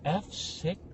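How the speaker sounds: soft deflated mumble; a passenger finding their seat is next to him, quietly dismayed; mock dismay. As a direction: let it sink in quietly with dread. Dismayed seatmate.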